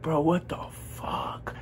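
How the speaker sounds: slow whispered disbelief; stunned whisper. Retold or imitated. Learning his friend just got jumped, stunned whisper. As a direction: whisper it slowly, dumbfounded. Acts out stunned inner reaction.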